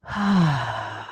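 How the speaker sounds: soft, falling sigh; sigh of release. Shown as a demonstration. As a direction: let out one breathy sigh to show letting go. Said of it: "just be like".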